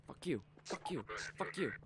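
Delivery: soft, low repeated curse; low-key spite. Pretend. Player jabbing at an opponent, soft spiteful cursing. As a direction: curse under your breath, jab by jab.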